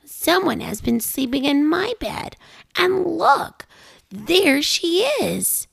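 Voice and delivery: tiny bear voice, high and slow on all gone; Baby Bear voice. A narrator's reading. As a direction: cry out like an upset little cub. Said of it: "Baby Bear said".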